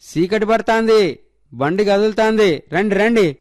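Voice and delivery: put-on high, loud call to the kids to come. A narrator's reading. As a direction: shout across the field that the cart is leaving. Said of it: "గట్టిగా అరిచాడు".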